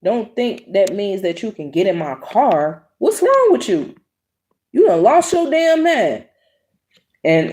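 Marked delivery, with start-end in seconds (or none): 3.0-6.2 much higher, incredulous yelling; reenacting telling him off; retold outburst. Retelling cussing out supervisor.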